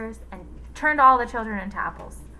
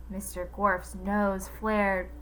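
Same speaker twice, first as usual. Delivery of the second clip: plain reading voice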